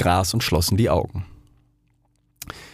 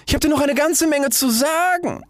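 high-pitched rocket voice calling after. A narrator's reading. Rocket calling after the duck, high and insistent. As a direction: call it out high, as to someone leaving. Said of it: "rief die Rakete".

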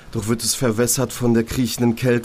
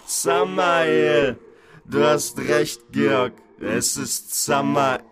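sinister inner voice; 3 semitones higher, much slower than usual. Acted. Inner voice names itself.

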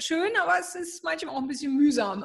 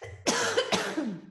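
coughing fit after swallowing wrong; hard cough to clear the throat; choking cough; 9 semitones higher than usual. Real, not acted. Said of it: "Ich hab mich verschluckt".

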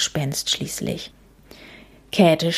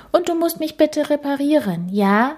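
whiny, pleading ghost, pitched higher; 9 semitones higher than usual. A narrator's reading. Ghost begging Käthe to fix her, whiny. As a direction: whine the request like a fussy child. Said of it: "mit quengeliger Stimme".

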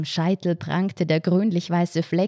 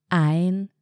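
long, drawn-out, level word; meditative Atemansage. Acted. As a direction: count yourself calmly through a breathing exercise. Entspannte Atemübung im Kopf angesagt. (gedehnt, wie bei einer Atemübung)

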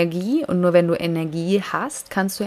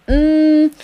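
loud, high "mm" of annoyance; acted miffed grunt; irritated hum; 7 semitones higher, 9 dB louder than usual. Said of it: "jetzt bin ich so ein bisschen pisst".

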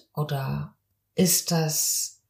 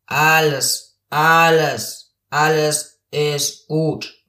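slow, drawn-out creak; demonstrating vocal fry, stretched out; creaky voice; 2 semitones lower, 4 dB louder, slower than usual. Shown as a demonstration. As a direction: start each word with a creaking onset. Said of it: "Das wäre Knarren".

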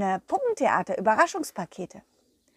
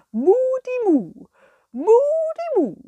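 cow voice; 13 semitones higher, 6 dB louder than usual. Acted. The cow Kurita greeting the children, high and mooing. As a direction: sing out your cow word like a puppet cow. Cow puppet calls its word.

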